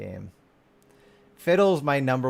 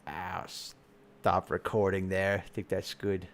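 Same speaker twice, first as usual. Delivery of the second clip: soft slow aside; 5 semitones lower, 7 dB quieter, slower than usual. Real, not acted. Aside to recorder.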